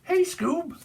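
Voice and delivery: very high cracking cartoon call; cartoon impression. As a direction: call to your cartoon dog pal in a squeaky voice. Scooby-Doo cartoon impression.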